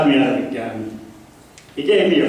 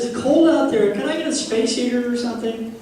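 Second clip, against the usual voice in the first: wife voiced; 9 semitones higher than usual.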